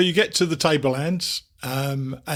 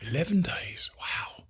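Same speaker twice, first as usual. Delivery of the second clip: surprised whisper; 7 dB quieter, slower than usual. Real, not acted. Surprised at long trip.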